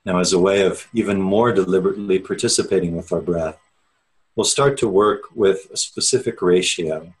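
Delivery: measured, unhurried meditation guide with long pauses; steady, spacious meditation-teacher delivery; guided meditation. Real, not acted. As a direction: ease listeners inward, leaving space after each phrase. Leads an opening meditation.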